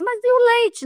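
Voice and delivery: bem mais aguda, incrédula; pergunta indignada. Retold or imitated. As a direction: pergunta de quem não acredita. Indignada ao saber que não há leite. (espanto indignado, bem mais agudo)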